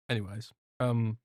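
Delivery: normal talking voice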